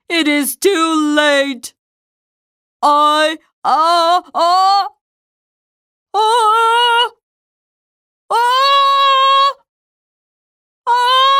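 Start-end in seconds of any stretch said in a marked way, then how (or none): 2.8-11.4 rising uh-uh before a sneeze; sneeze building up. Acted. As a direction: hold the build, climbing higher.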